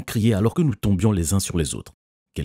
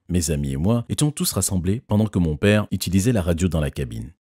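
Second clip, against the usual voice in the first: ordinary delivery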